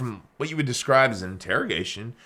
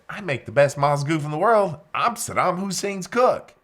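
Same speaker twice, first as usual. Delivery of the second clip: higher, louder proud cook bragging; proud boast; 7 semitones higher, 4 dB louder than usual. Retold or imitated. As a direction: brag like the proud chef. Cook boasting about his dish.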